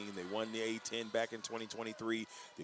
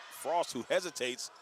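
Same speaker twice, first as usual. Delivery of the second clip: higher, energized basketball call; excited play-by-play; 4 semitones higher than usual. Real, not acted. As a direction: call the big shot as it drops, voice lifting.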